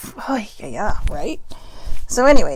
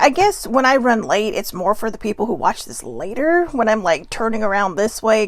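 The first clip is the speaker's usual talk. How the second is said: usual speaking voice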